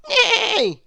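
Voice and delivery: loud, high, wavering neigh; whinny like a horse. Acted. Old horse in the farmyard tangle, whinnying. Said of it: "whined the old farm horse".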